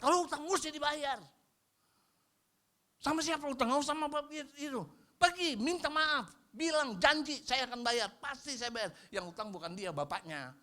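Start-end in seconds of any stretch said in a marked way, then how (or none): 0.0-8.9 high, loud preaching; fiery preaching. Acted. Pastor urges the congregation to own mistakes, fiery and high. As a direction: drive the point home.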